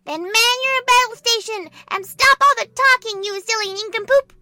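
loud, high, bossy shout; scolding order. Acted. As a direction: yell at a chatty subordinate with an insult. General orders and insults the private.

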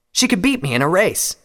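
high, loud admission; serious Scarlet. A narrator's reading. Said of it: "his face now serious".

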